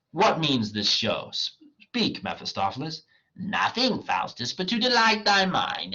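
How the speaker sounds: reading a scene aloud with mild character voices; dramatic play reading. Acted. Teacher reading Faustus to the class, lightly acted. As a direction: read it like a stage script for class, lightly acted.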